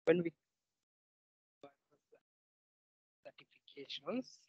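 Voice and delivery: very faint, far from the mic; almost inaudible speech; barely audible. Real, not acted. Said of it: "Your voice is very faint".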